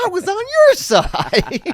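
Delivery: high, loud, drawn-out mock wail; indignant protest. Comic protesting to the man who told him off, indignant wail. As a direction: shout it as a hurt, hammed-up protest. Retold protest to street preacher.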